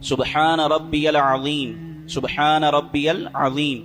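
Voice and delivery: سبحان drawn out very slowly with a clear throaty ع; slow careful tasbih with a distinct ع; clear ain sound. Shown as a demonstration. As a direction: stretch each word of سبحان ربی العظیم, sounding the ع from the throat. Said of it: "یہ دیکھ رہے ہیں تلفظ عین کا".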